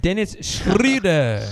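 over-German announcer pronunciation, high and drawn out; mock German stadium intro; too-German arena announcer. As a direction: stretch the name into an exaggerated German accent. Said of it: "make Dennis Schroeder's name sound too German".

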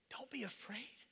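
incredulous whisper, rising into a question; stunned, voiceless echo; whispered disbelief. Acted. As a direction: whisper it back in utter disbelief. Servant repeats Elisha's words in disbelief.

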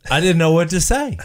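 ordinary delivery

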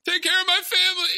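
high, fast, gasping last words; hammed-up dying cop plea. Mocking cliched dying-cop scene.

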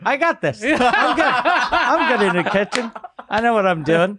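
high-pitched, chuckling cocky act; laughing mock confidence. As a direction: brag through your laughter. Acting out an overconfident cook.

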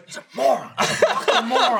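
high, laughing put-on insult; mock Jeremy. Imitating Jeremy insulting Trevor, laughing. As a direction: sneer it through laughter. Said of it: "Jeremy just looks at me and goes".

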